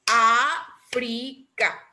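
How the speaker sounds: África very slowly as Á, fri, ca; África with long first Á and pauses between syllables. Shown as a demonstration. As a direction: break África into three separate beats. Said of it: "Tiene tres sílabas".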